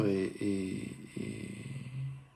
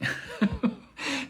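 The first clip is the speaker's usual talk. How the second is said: a short rising nervous laugh; quick self-conscious titter; nervous giggle. Real, not acted. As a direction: laugh it off, a little embarrassed. Nervous about being boring.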